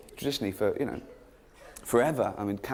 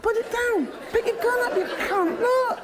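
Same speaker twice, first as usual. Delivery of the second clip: high, loud mock army shout at a poet; mock soldier yell; 3 dB louder than usual. Trench soldier yelling at the poet with a pen, high hammed-up shout. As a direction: bark at him like a furious trench sergeant.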